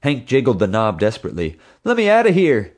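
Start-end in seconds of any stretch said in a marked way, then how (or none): 1.8-2.7 high, loud whine at the locked door; whining. A narrator's reading. Hank whining to Mom to be let out, high and loud. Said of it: "he whined to Mom".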